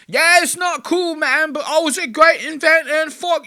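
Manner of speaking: very high, loud cartoon British squawk; exaggerated British accent. Streamer imitates player blowing past complaint, crazy British accent. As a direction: barrel on in a hammed-up British screech. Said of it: "Dan just keeps going like".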